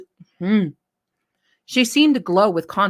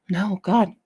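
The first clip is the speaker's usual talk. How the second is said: usual speaking voice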